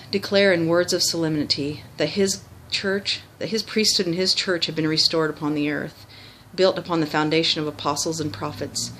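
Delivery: ordinary delivery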